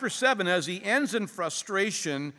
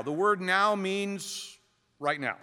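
ordinary talk, nothing marked

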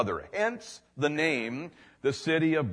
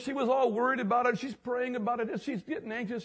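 much higher, astonished retold voice; amazed man; 9 semitones higher than usual. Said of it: "he goes".

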